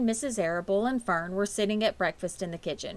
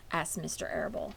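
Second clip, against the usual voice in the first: ordinary delivery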